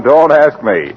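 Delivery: ordinary talk, nothing marked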